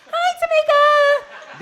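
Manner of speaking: high, slow, drawn-out chirpy hello; sing-song greeting. Retold or imitated. Greeting friend in put-on voice.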